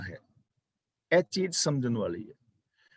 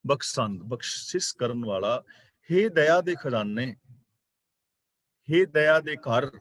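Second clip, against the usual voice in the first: ordinary delivery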